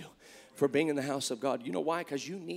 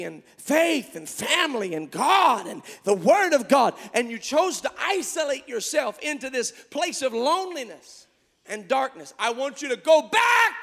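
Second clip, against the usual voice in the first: louder, higher, rising to a shout; preaching at full force; 8 semitones higher, 10 dB louder than usual. Acted. Preacher calling hearers back to the cave, shouting. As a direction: climb and shout the call.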